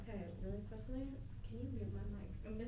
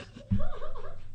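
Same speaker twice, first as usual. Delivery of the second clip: loud, high burst of laughter; high cackling laugh; big laugh; 13 dB louder than usual. Real, not acted. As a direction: crack up at the squished chair. Laughing at squished chair.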